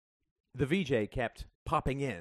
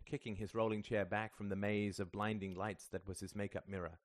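ordinary delivery, nothing marked

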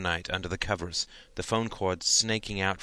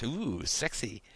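teasing; 3 semitones higher, 4 dB quieter, much slower than usual. A narrator's reading. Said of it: "Alice laughed".

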